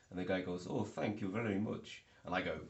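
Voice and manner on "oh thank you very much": imitated Eastern European accent, raised pitch; put-on Eastern European accent. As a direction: thank politely in a mock Eastern European accent. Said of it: "he is Eastern European".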